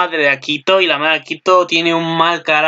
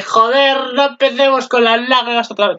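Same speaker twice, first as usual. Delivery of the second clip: exasperated outburst; 8 semitones higher than usual. Real, not acted. Dreading more crying episodes.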